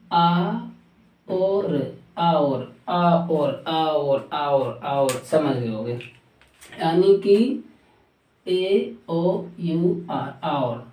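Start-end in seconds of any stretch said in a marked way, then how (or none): 0.1-5.1 आ और in two open parts, "aa-or"; correct hour. Shown as a demonstration. Teacher drills learners, आ और as "aa-or" without v. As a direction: say आ और lower, split and falling. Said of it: "इसको पढ़ते हैं".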